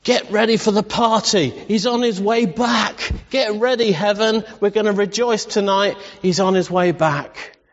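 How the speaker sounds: high, excited announcing cry; father calling heaven to celebrate; joyful call. Acted. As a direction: call out the good news with joy. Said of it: "he's saying".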